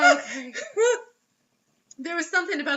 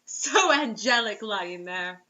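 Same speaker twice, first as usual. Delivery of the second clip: mock dreamy; much slower than usual.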